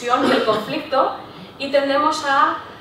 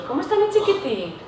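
sweet diminutive; 5 semitones higher than usual. Shown as a demonstration. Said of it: "muchos más diminutivos".

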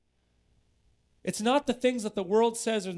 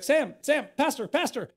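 calling out; 8 semitones higher, 5 dB louder than usual. Retold or imitated. Principal calling after him.

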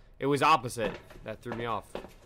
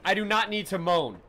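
annoyed retort; 7 semitones higher, 6 dB louder than usual. Real, not acted. Retort to chat.

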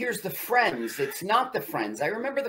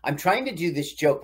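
ordinary talk, nothing marked

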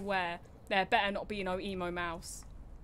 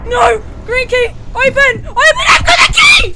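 high, loud terrified screams; real panic, rising higher into shrieks; panicked screaming; 14 semitones higher, 23 dB louder than usual. Real, not acted. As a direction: scream for the door to open. Chased in horror game, screaming.

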